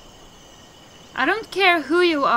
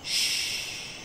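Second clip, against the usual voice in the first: a soft whispered shh; secretive unvoiced shh; hushing whisper; 9 dB quieter than usual. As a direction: hush them in a whisper. Madman hushing everyone.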